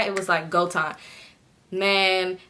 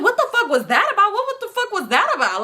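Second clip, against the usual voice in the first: mock indignant echo; 12 semitones higher than usual. She fires the question back at her sister, exasperated.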